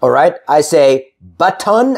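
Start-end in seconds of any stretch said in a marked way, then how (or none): 1.2-2.0 button as but-TAWN; over-full second syllable. Shown as a demonstration. Teacher showing what to avoid, button with full "tawn". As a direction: give button a full O in "ton". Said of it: "I don't say button".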